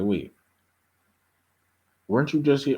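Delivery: rising high on "weren't you just here"; imitating confused Isaac. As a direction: play the bewildered father. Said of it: "So Isaac was like".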